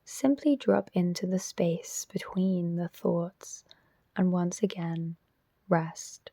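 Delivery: measured, spacious delivery, leaving silence after each instruction; guided meditation. Real, not acted. Teacher guiding listeners into self-inquiry, guided meditation voice.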